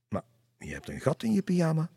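high, surprised remark; father spotting the hole, surprised. Acted. Father notices torn pajamas.

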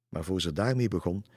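plain reading voice

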